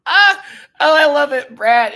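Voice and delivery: very high, loud squeal of joy; shrieking joy at Brad; delighted shriek. Real, not acted. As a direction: squeal like you can't contain it.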